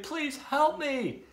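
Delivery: high, drawn-out plea; helpless storybook wail; pleading yell. A narrator's reading. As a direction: stretch out a high cry for help. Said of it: "yelled Tudley".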